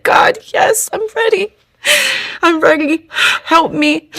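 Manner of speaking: very high, thin, choked-up plea; retold prayer on the edge of crying; tearful surrender. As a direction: give in to God, voice breaking upward with tears.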